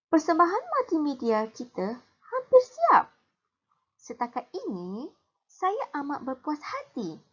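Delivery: pleased student chatting in the canteen; hint of a schoolgirl voice; lightly voiced schoolgirl. Reads girls' dialogue.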